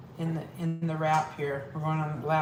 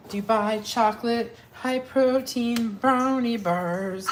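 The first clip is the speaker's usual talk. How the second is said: product reveal; 6 semitones higher, 3 dB louder, slower than usual. Acted. Presenting the finished product.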